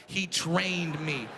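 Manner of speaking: ordinary delivery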